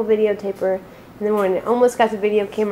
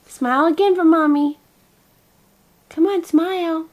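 high, sing-song coaxing; 6 semitones higher, 4 dB louder than usual. Real, not acted. Mother coaxing her baby to smile, high baby talk.

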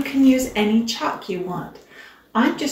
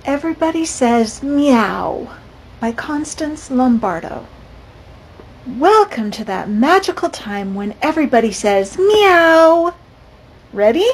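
put-on lively, louder storybook reading for kids; picture book read-aloud; 3 semitones higher, 7 dB louder than usual. A narrator's reading. Teacher reading a picture book to her class, lively.

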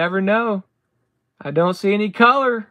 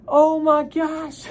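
ordinary delivery

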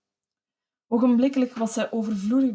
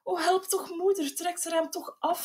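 much higher, softer, begging through sobs; sobbing plea; 8 semitones higher, 3 dB quieter than usual. A narrator's reading. Eldest girl begging her mother's rescue, sobbing. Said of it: "snikte smekend het oudste meisje".

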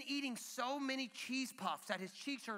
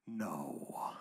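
very low, drawn-out no; deadpan refusal. Acted. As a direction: refuse dad-style, deep down in your register. Dad refuses a second time.

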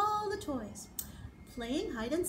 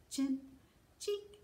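plain reading voice

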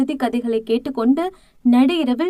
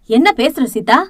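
a sharp, louder rebuke; scolding; 5 dB louder than usual. Acted. Aunt Meenakshi snapping at Seetha, louder and scolding. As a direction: cut her off with a firm scold. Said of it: "அதட்டல் போட்டார் மீனாட்சி".

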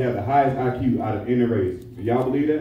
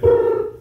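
high whistled brrr sound; whistle blow; 5 dB louder than usual. Retold or imitated. Coach stops the game with a loud whistle. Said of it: "He said".

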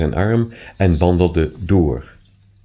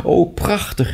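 high exclamation of delight; thrilled, pitched well up; delighted cry; 9 semitones higher, slower than usual. A narrator's reading. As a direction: exclaim it with rising wonder. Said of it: "riep ze".

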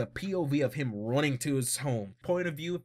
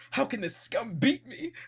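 freaking out; 8 semitones higher than usual. Retold or imitated. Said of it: "freaking out".

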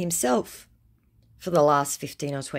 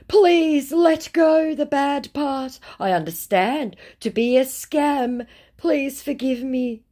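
slow, high, drawn-out imitation of begging; mock pleading; 11 semitones higher, 5 dB louder, slower than usual. Scammer asking to be forgiven for scamming, mock pleading. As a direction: implore in an exaggerated raised voice.

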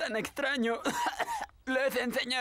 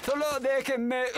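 ordinary talk, nothing marked

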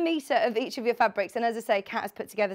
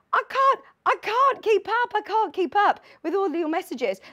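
flustered; 9 semitones higher, 5 dB louder than usual. Real, not acted. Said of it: "If I'm being brutally honest".

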